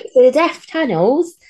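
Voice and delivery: high, slow put-on voice; sing-song kids' character voice; silly character voice. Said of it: "talking like Mister Turnbull".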